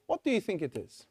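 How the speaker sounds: ordinary talk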